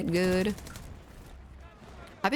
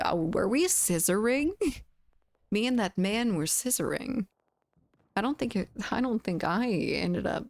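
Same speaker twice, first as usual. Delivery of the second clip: ordinary delivery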